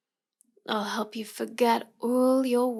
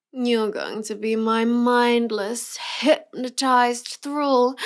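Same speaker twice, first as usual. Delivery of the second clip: slow menace; slower than usual. Acted. Declaring hypnotic control.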